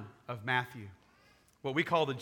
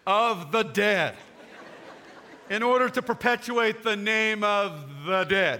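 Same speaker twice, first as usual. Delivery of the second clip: louder, slow, weighted words piling on; hammed-up warning; 4 semitones higher, 6 dB louder, slower than usual. Boaz warning the relative, loud and slow with heavy stress. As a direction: lean hard on each drawback, slowly. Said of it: "That's kind of what he's about to do".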